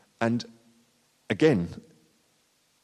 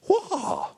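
mock battle cry. Mimics Joan of Arc battle cry.

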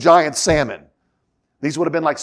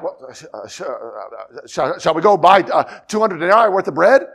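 flustered; 3 dB louder than usual. Acted. Stunned disciples replying to Jesus, stammering and flustered. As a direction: stammer on "sh-" before getting the question out.

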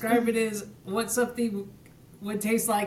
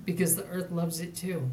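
commercial voice; 4 semitones lower, 7 dB quieter than usual. Acted. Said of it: "I learned that from practicing for commercials".

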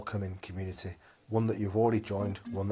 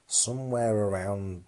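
ordinary talk

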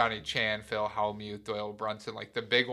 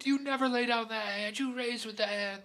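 much higher, indignant retold rant; mock freaking out; 12 semitones higher than usual. Russian mobster ranting at the table, high mock indignation. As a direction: squeal the rules back at him in disbelief. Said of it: "he just is freaking out".

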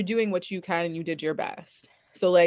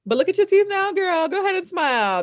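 high, louder playful coaxing; teasing; 12 semitones higher, 5 dB louder than usual. Real, not acted. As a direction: coax her to smile. Teasing friend to smile.

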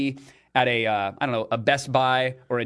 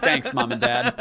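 sarcastic thanks; 9 semitones higher, slower than usual. Retold or imitated. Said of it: "I'm like".

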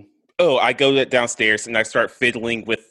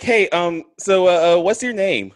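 a higher, chummy stalling voice; awkward distraction; 6 semitones higher, 4 dB louder than usual. Acted. Character to the barmaid, trying to distract her. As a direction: stall her with fake friendliness. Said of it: "I'm like".

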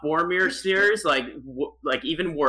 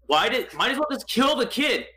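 ordinary delivery

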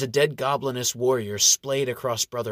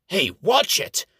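character voice; 6 semitones higher, 5 dB louder, slower than usual. A narrator's reading. Older elf startled.

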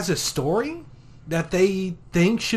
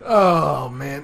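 ordinary talk

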